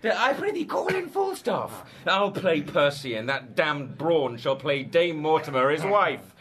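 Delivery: ordinary delivery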